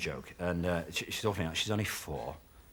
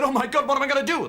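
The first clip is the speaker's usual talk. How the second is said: much higher mock panic; panicking motorist; 6 dB louder than usual. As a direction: shriek it in hammed-up alarm.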